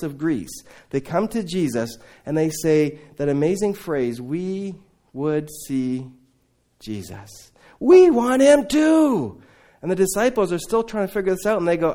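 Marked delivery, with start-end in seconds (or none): 4.2-9.4 eager Greeks. Retold or imitated. Greeks asking to see Jesus.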